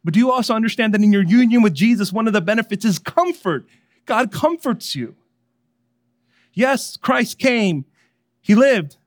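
high, loud preaching with emphatic phrases. Real, not acted. Preaches at full force.

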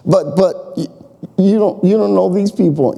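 a fretful believer stammering; anxious pleading. Retold or imitated. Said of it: "believer protests".

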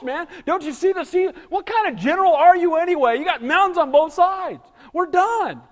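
hammed-up terror, voice cracking high; panicking. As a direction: lose it at the sight of the army. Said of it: "And they're panicking".

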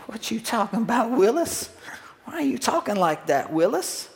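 high-pitched mock-disbelieving catchphrase; Gary Coleman. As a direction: put on a high, swooping sitcom kid voice. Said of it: "and Gary would say".

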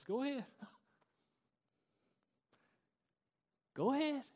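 high-pitched, offhand permission; light, high voice giving in; permissive go-ahead. Acted. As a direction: let them go with a lifted, lilting voice. God letting fools have their way.

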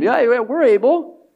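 mock eager disciples; eager boast. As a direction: blurt it out like keen disciples.

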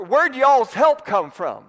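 hammed-up Southern accent; Alabama twang. Shown as a demonstration. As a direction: ask it like a folksy Alabama preacher. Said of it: "That's not how they'd say it in Alabama".

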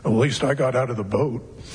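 low, smug retort; a touch of a proud disciple getting the last word; Peter's comeback. Retold or imitated. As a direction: deliver it as a cheeky one-up. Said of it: "and Peter goes".

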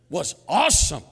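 mock-gushing, loud and high; hammed-up fatherly raving; bragging dad. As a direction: belt out the praise like a proud dad. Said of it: "He would always brag about".

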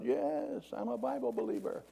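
high-pitched mock call from above; thin acted call back; yelling down. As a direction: imitate a distant holler without much volume. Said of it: "The guy yells down".

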